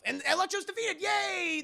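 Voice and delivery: high-pitched cheer; mock celebration. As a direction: cheer as if it's all over. Mock celebration of villain's defeat.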